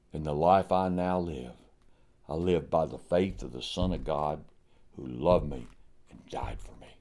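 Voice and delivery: fading to a soft, low hush; hushed reverence. Acted. Preacher quotes Paul's testimony, fading reverently to a hush. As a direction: let it sink away into near silence.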